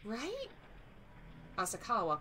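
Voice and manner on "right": fading frightened plea. Acted. Wife pleading with her silent husband, scared.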